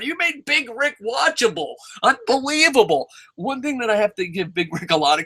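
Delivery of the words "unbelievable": usual speaking voice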